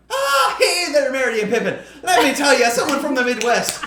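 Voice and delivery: high, loud mock Midwestern holler; big cheery put-on Midwest accent; hammed-up Midwest accent. Host acts voicemail to podcasters, hammed-up Midwest accent. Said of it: "your Midwest accent was fucking insulting".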